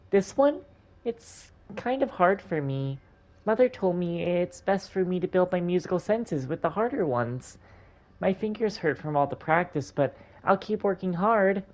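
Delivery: put-on little girl. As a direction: play an eager little girl.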